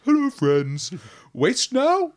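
a mind-speaking horror greeting its prey; creepy monster voice. Acted.

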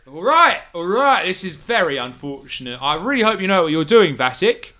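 nervous thug caught at knifepoint; collared ruffian pleading with the captor. Acted. Thug speaks to Vatic.

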